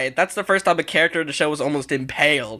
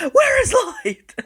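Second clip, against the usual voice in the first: squeaky high mock wail; high squeaky cartoon cry; high squeak; 3 dB louder, slower than usual. Mock cry reacting to scene.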